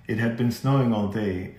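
ordinary delivery, nothing marked

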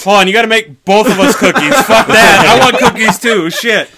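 high, loud, fast demands; loud and excited. Real, not acted. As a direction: insist on it loudly. Demanding cookies loudly.

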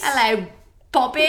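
a cheery put-on British hello, near laughing; hammed-up British hello; British accent.

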